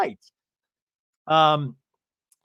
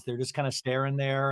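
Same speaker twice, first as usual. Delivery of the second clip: ordinary talk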